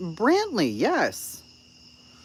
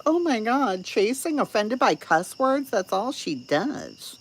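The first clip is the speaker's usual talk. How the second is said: high, louder whiny mimicry; mocking imitation; 10 semitones higher, 4 dB louder than usual. Streamer imitates the ocean lady complaining about her, mocking.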